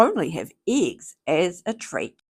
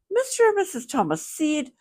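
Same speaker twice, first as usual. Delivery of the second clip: ordinary delivery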